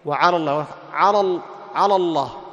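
"على الله" with both lams thickened; common reciters' mistake. Shown as a demonstration. Teacher imitates many reciters' error, "على الله" heavy throughout. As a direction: darken "على" into a heavy alo before "الله". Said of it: "وهذا يقع فيه كثير من القراء".

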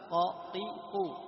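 slow, even قا قي قو, each the same length; equal short vowels. Shown as a demonstration. Teacher drills students on qaf with each vowel. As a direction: tap قا قي قو evenly. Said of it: "لا فرق بين زمن القاف".

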